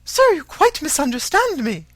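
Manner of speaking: high, loud, alarmed protest. A narrator's reading. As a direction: hurry to correct him in alarm. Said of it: "said Missus Bennet, alarmed".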